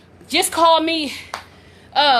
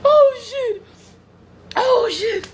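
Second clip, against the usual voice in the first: tearful laughter; 6 semitones higher, much slower than usual. Real, not acted. Winding down from laughing fit.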